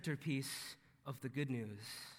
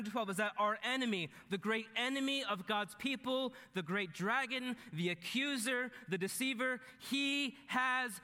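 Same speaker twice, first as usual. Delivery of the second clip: raised pitch, punching key words; emphatic preaching; 7 semitones higher, 4 dB louder than usual. Real, not acted. Preacher declares the enemy thrown down, emphatic preaching. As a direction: preach it in triumph, slowing right down for the last phrase.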